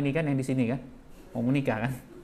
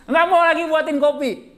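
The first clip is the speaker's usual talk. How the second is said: high, loud joking punchline; hammed-up sulky-wife list; comic punchline; 12 semitones higher, 8 dB louder than usual. Listing wife's sulking acts. (lelucon dengan suara tinggi)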